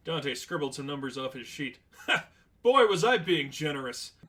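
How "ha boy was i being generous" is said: laughing. Acted. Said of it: "Dante laughed even harder".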